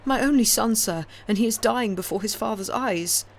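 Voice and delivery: high, loud desperate cries for help; frantic pleading. A narrator's reading. Old father begging stranger to save his son, frantic. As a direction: beg for your drowning son. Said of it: "said the old man frantically".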